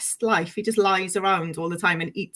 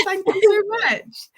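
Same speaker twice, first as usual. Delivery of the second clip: heartfelt thanks; 11 semitones higher, 4 dB louder, slower than usual. Real, not acted. Moved by compliment.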